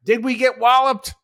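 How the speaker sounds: ordinary talk, nothing marked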